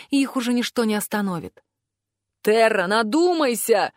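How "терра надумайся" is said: slow, drawn-out warning cry; restrained shout of warning; calling out. A narrator's reading. Master Tolli warns an armed colleague, calling out. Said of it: "крикнул магистр Толли".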